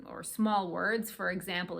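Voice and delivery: ordinary talk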